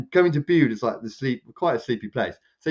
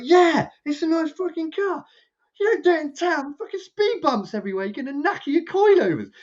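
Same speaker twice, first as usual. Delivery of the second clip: high-pitched mock rant at the driver; he's reliving telling the guy off, voice pushed way up; retold telling-off. To the flash BMW driver about speed bumps, a high, heated mock rant.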